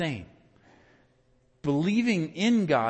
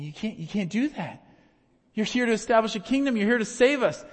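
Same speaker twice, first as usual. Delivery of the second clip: higher, urgent protest; protesting disciples; 7 semitones higher than usual. Acted. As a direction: plead against it, pitch up. Disciples protesting Jesus's death.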